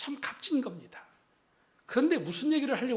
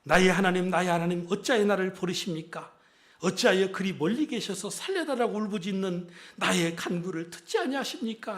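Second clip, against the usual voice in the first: loud lament, louder at the start; crying out; 4 dB louder than usual. Retold or imitated. Pastor voicing David crying out in Psalm 22, loud and anguished. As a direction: cry it out to God in despair. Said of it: "이렇게 부르짖었어요".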